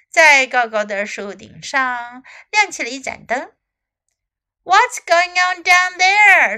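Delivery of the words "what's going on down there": high, loud storybook call; shout. A narrator's reading. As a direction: shout it out as the disturbed squirrel. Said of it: "shouted a sleepy-eyed squirrel".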